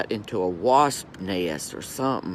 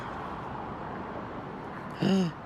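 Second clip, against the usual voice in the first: soft gasp; 6 semitones higher, 9 dB quieter than usual. Real, not acted. Spots the wounded wasp.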